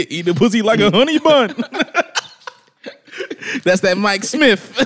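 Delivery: high laughing words; words cracking up with laughter. Real, not acted. Laughing through a joke.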